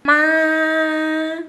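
"Ma" held high and level; first-tone demo. Shown as a demonstration. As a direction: sustain "Ma" on one high, loud pitch. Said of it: "esa sílaba con diferentes, ah, tonos".

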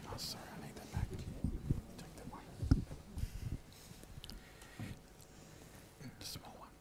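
hushed aside whisper. Real, not acted. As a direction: whisper so the guests don't hear. Whispering during ring exchange.

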